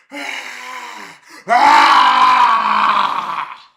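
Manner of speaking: a huge standing lion's roar, the second ah yelled very loud; the lion's roar, the last ah a full-force howl. Retold or imitated. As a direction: bellow like a lion rearing up on its hind legs. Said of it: "like that".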